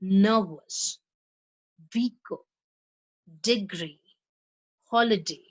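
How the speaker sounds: each word said with its stressed syllable clear; word stress drill. Shown as a demonstration. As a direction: model word stress for the class. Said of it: "say after me".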